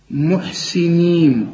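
slow, drawn-out tajweed chant; Quran recitation. Shown as a demonstration. Tajweed teacher demonstrates a word to students, slow chanted recitation. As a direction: recite it slowly with thin, light letters. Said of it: "برضو الحروف دي مرققة".

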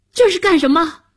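high, loud indignant question; defiant boy. A narrator's reading. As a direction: demand an answer like an unyielding young boy. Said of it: "又很不服气".

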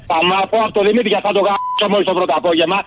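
high-pitched furious threatening; furious victim threatening the prankster, pitched high; angry threat. Real, not acted. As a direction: threaten him through rising anger.

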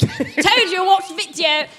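lightly put-on British video narrator; British accent impression. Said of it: "she talked like this through the whole video".

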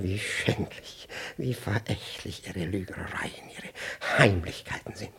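slow, low and soft, full of contempt; bitter brooding. Acted. Official brooding aloud about a lying woman, slow and quiet. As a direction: savour each word with quiet scorn.

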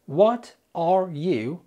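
"What are you" stretched out, each word held apart; very slow word by word. Shown as a demonstration. Teacher slowing the phrase down for learners. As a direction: crawl through each word of the question. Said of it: "لما بتتقال سريع".

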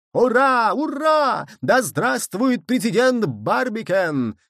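high, loud, drawn-out hurrah; shouted cheer. A narrator's reading. Nicholl hails president Barbicane, high shouted cheer. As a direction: cheer high, then stretch the acclamation. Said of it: "кричал Николь".

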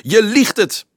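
high-pitched, outraged yell; accusing shriek. A narrator's reading. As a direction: hurl the accusation out. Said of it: "gilde hij". (woedend gegilde beschuldiging)

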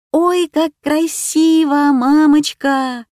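put-on high, slow, drawn-out child's marvel; delighted wonder. A narrator's reading. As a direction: gasp in delight at something beautiful. Girl marvels at the new toys.

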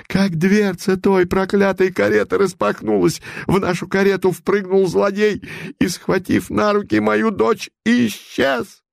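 agitated merchant's wife. Acted. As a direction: tell it like a distraught matron. Купчиха рассказывает о похищении. (взволнованная купчиха, намного выше)

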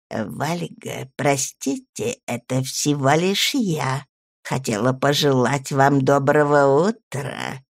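put-on soft, slow, polite elderly lady; gently apologetic old woman; sweet old lady. Old baroness speaks sweetly.

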